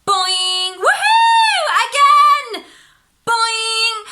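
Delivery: very high, loud sung boings and whoops; wild excited whoops. Acted. Nina bouncing wildly on her spring, high gleeful whoops. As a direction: whoop with pure glee.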